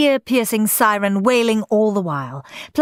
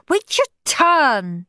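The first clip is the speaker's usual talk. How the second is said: higher, slow scolding shout; 10 semitones higher, 3 dB louder, much slower than usual. A narrator's reading. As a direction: tell Henry off in the queue. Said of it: "shouted Moody Margaret".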